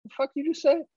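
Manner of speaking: high, put-on baby voice snapping back; toddler's retort. Daughter mocking dad's words, high put-on indignant voice. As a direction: voices the little daughter as outraged. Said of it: "She's like".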